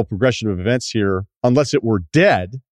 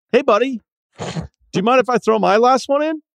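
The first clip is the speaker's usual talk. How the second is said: much higher, bright friendly voice; friendlier. Shown as a demonstration. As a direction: sweeten it way up for the kid. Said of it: "Let's try that friendlier".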